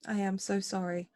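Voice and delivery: careful, heartfelt and subdued; sorrowful apologetic older sister. Acted. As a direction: hold composure while apologizing to a sister. Speaks in character to younger sister.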